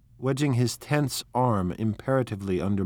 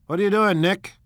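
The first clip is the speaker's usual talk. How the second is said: brusque loud question; 10 semitones higher, 7 dB louder than usual. Acted.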